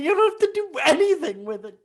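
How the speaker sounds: high, mocking parroting of her words; mocking his mother. Son mimicking his mother's advice, high and mocking. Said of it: "Is that my mother talking?".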